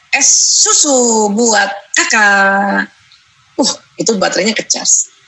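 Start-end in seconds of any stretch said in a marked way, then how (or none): 0.1-2.9 tender offering. Retold or imitated. Mother serving child iced milk.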